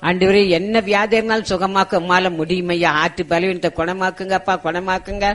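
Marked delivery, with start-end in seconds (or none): none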